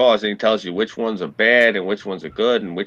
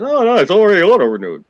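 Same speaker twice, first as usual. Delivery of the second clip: higher, louder and fast, mocking the clerk's brush-off; 9 semitones higher, 6 dB louder than usual. Ranting to a friend, quoting the library clerk in a mocking, higher, faster voice. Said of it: "she said".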